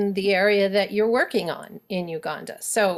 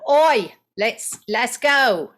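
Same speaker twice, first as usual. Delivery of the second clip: loud British call with a raised voice; 3 semitones higher, 4 dB louder, slower than usual. Shown as a demonstration. Coach demos British oi, loud British accent. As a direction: call a mate over in British style. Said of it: "they say "oi" in Britain".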